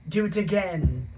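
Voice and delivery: put-on high, loud, slow demand of a spoilt boy; bossy order. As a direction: bark it at his father like a little tyrant. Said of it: "Dudley ordered".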